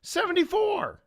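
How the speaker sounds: high, loud shout; shouted number. Retold or imitated. Young convict trying his first joke number, high loud shout. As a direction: yell it proudly through the bars. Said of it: "he shouts out".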